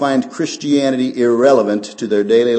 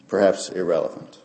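ordinary talk

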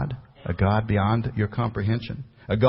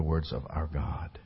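breathy unvoiced whisper; whispered God; 5 semitones lower, 9 dB quieter, slower than usual. Real, not acted. Pastor to congregation about trusting men, whispered. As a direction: let the last word drop to a whisper. Sermon line fading to breath.

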